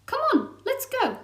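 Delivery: high, loud glee; excited squirrel. Acted. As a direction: cheer it out. Eager squirrel.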